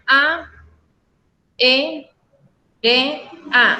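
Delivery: "A, E, R, A" as a, e, re, a with pauses; separated syllables. Shown as a demonstration. Teacher dividing aérea for students, syllable by syllable. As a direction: leave gaps between the syllables of "A, E, R, A". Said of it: "se separan".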